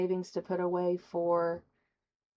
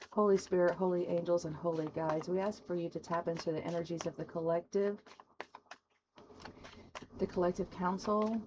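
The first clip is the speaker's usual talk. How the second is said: soft, hushed prayer to the spirits; prayer invocation; 3 dB quieter than usual. Acted. Reader invoking spirit guides for the collective, softly. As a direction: call on the angels softly, reverently. Opening prayer before card pull.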